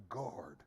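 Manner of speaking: guard said low and soft, drawn out; guard spoken quietly as the key word; stress on guard. Shown as a demonstration. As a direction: lean on the single word guard. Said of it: "I just want to emphasize a word".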